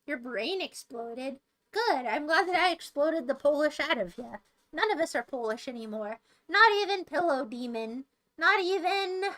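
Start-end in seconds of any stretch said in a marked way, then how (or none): none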